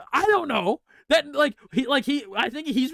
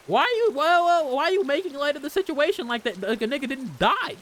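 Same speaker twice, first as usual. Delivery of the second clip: agitated character line; 5 semitones higher than usual. Acted. Voices panicked Keiichi line.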